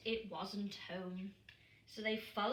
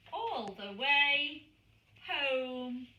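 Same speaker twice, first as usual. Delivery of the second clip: slow triumphant build; 2 semitones higher, 8 dB louder, slower than usual. A narrator's reading. Climactic storybook line.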